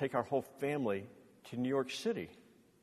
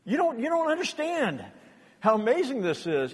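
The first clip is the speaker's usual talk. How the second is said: wife's amazement; 9 semitones higher than usual. Retold or imitated. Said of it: "she goes".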